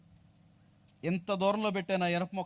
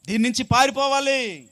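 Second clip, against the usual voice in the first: high, loud climactic warning; full-force preaching; 7 semitones higher, 9 dB louder than usual. Real, not acted. Preacher warns evil thought is like a tiger, full-force preaching. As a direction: cry the warning out to the whole congregation.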